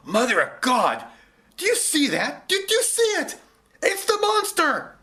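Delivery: very high, loud amazed cry; man gasping at the monster; astonished shout. Acted. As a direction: stammer in shock.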